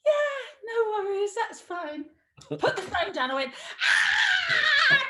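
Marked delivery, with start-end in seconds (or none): 0.1-2.0 high, strained mock-cheerful reply; feigned nonchalance. Hiding hurt on the phone. 3.8-5.0 very high, loud mock scream; comic wail of anguish. Acting out distress after hanging up.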